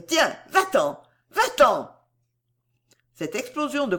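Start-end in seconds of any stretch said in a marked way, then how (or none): 0.1-1.9 higher, louder cry to drive him out; angry shout. A narrator's reading. Girl chasing her admirer away, angry shout. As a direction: send him away in a temper. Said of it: "elle cria".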